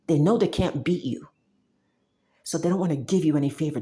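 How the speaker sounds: ordinary delivery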